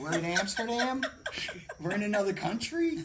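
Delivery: incredulous, laughing retort; laughing disbelief. Retold or imitated. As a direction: ask it in cracking-up disbelief.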